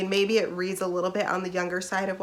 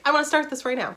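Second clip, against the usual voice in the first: higher and louder, all eager; eager excitement; 6 semitones higher, 5 dB louder, faster than usual. Retold or imitated. Telling viewers about getting approved, excitedly eager. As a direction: blurt it out like you can't wait. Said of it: "I was like".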